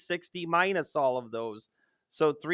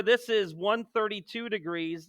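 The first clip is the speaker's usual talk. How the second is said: usual speaking voice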